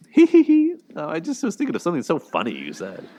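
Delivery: passive-aggressive high fake giggle; Ross. Commentator imitating a passive-aggressive sitcom boyfriend, mock giggling. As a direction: put on a loud, high titter, then a falsely amused high voice. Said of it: "the way he'll just be like".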